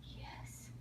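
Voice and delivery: soft whispered yes; whispering. Real, not acted. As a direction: breathe the word, no voice. Whispered praise to a toddler.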